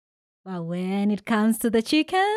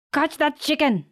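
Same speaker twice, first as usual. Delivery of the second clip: a touch higher mild call; lightly voiced call out; shout; 6 semitones higher, 3 dB louder than usual. A narrator's reading. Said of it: "shouts Daddy Ndalami".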